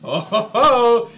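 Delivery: loud, slow gleeful oh ho ho; excited. Real, not acted. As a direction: gloat loudly and slowly. Reacting to a good move.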